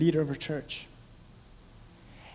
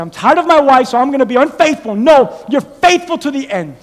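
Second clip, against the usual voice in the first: raised, punchy exhortations in short bursts; forceful preaching; 8 semitones higher, 12 dB louder than usual. Real, not acted. Preacher rebuking excuses for being unfaithful, forceful preaching. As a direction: drive each command home to the congregation.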